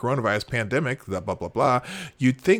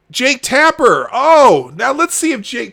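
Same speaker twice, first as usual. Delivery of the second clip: loud, high announcing of the name; heightened call-out; 10 semitones higher, 10 dB louder than usual. Real, not acted. Host spotting Jake Tapper's post next, worked up. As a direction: pounce on the next target.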